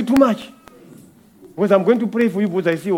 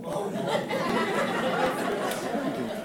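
soft breathy laugh; 4 semitones higher, 12 dB quieter than usual. Real, not acted. Mocking refusal of odd food.

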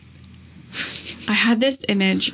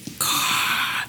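long, voiceless whoosh of dragon fire; imitated dragon fire-breath; 6 dB quieter than usual. Showing friend the dragon in Sleeping Beauty, fire-breathing noise. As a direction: breathe out hard like a dragon blasting flame. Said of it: "the sound of the dragon, like, pushing out fire".

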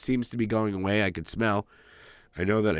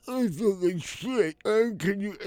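high, slow, pitiful whimper; playing up how sorry for himself he was; complaining to his girlfriend in a high, slow whine; mock sick whine; 11 semitones higher, slower than usual.

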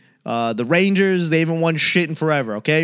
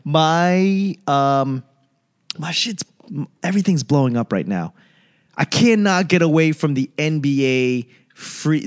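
usual speaking voice

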